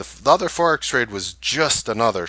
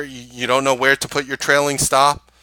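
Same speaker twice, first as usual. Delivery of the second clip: ordinary talk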